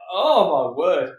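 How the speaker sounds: ordinary talk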